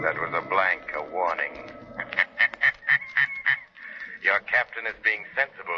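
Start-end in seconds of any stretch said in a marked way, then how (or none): 2.0-3.6 a high, unearthly, echoing cackle from nowhere; haunt them with laughter; eerie Shadow laugh. Acted. The invisible Shadow unnerves Richter with a high, eerie cackle.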